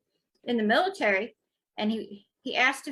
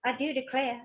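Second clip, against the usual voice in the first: Southern belle. Pretend. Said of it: "should I say Miss O'Hara".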